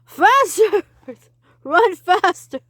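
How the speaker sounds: high loud yelling; urgent shout. Acted. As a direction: yell to hurry them on. Urging while fleeing.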